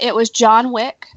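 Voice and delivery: ordinary talk, nothing marked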